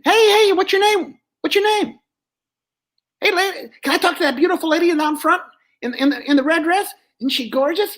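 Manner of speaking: high, brash dummy voice; Joey the dummy. Acted. Dummy flirting with the lady down front, high and brash.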